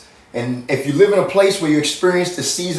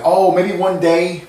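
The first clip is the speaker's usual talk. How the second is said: slow, drawn-out mock wishing; wishful musing; 6 semitones higher, slower than usual. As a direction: imitate someone vaguely hoping, dragging it out. Voicing a wistful hopeful thought.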